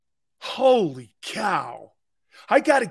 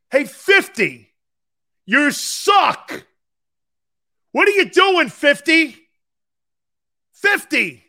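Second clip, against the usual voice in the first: high, loud, drawn-out yelling at a player; berating a rookie by his jersey number; coach yelling; 11 semitones higher, 11 dB louder, slower than usual. Retold or imitated. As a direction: bark the player's number down the field. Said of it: "Mike goes like this".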